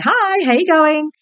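cheerful high hello to a friend; bubbly greeting. Retold or imitated. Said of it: "I'm always quite bubbly".

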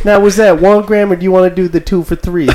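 laughing clerk upselling grams; mock fast-food clerk, amused; drive thru speaker. Playing drug drive-thru clerk.

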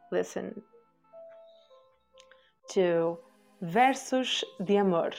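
ordinary delivery

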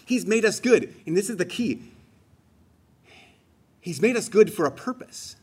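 normal talking voice

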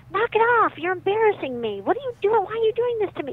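high, loud, exasperated scolding; embarrassed mom snapping. Retold or imitated. Embarrassed mom scolds her crying boy at preschool, exasperated. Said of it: "the mom was getting very embarrassed".

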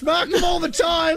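slow, high shouting; exasperated shout. Real, not acted. Frantic at muted caller.

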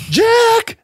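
shrill, sharp yelp way up high; Don Knotts. Retold or imitated. Doing Don Knotts on Three's Company, high yelp. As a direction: yelp the name, high and loud. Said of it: "like Don Knotts".

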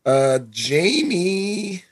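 cracking, breaking voice. Real, not acted. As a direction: voice cracks on the name. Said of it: "My voice just cracked like".

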